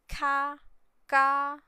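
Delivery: ka said high and loud; ka called out high, twice; tone demo. Shown as a demonstration. Teacher showing the tone of ka.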